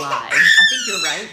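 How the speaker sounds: high laughing squeal. Real, not acted. Mom laughing at a viewer's suggestion.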